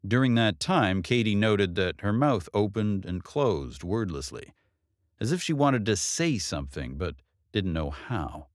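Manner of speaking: plain reading voice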